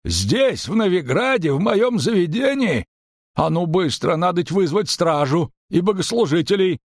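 high-pitched, scandalized innkeeper; agitated protest, pitched well up; alarmed outrage. A narrator's reading. Innkeeper outraged, calls for guards.

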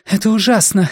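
breathless exclaim, fast breathing; hyperventilating. Acted. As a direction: gasp it out, out of breath. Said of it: "воскликнула Вера, часто дыша".

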